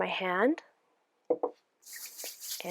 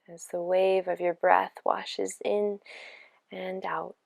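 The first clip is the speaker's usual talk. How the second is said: unhurried meditation voice with long pauses; guided meditation. Acted. Yoga teacher easing listeners into their breath and body, guided meditation. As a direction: guide them gently into rest, phrase by phrase. Leads guided relaxation practice.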